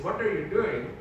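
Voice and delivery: mimicked Indian accent. Dad questioning why the teacher called him in, Indian accent. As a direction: quote it back in an Indian accent. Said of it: "she said something with an Indian accent".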